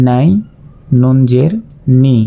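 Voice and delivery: ordinary talk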